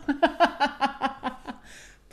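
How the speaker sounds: long, high, loud peal of laughter; self-amused laugh. Real, not acted. Podcaster admits she wants it all now, laughing long. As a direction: laugh hard at your own impatience. Laughs at her own impatience.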